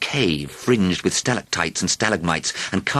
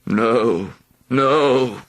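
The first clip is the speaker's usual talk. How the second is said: feeble; 6 semitones higher, 4 dB louder than usual. A narrator's reading. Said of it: "agreed the dragon feebly".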